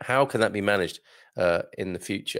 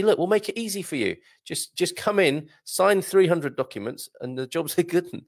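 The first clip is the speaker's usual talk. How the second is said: higher-pitched, easygoing sales pitch; lightly voiced helpful banker; 7 semitones higher than usual. Imagined Portuguese bank wooing unbanked Brits, mock helpful pitch. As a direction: coax a customer in, light and higher. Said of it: "Portuguese banks, reach out to these Brits ... and say".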